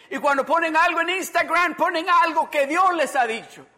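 loud, high, driving sermon delivery; forceful preaching. Real, not acted. Preacher telling everyone they only share God's word, forceful. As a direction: preach it out loud in insistent waves.

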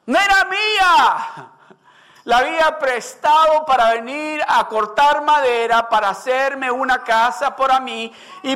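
high, pleading woodcutter's lament; desperate plea. Acted. Woodcutter telling the prophet his axe was borrowed, pleading. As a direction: beg like a man in trouble.

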